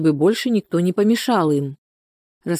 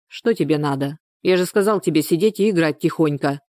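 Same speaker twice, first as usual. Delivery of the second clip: usual speaking voice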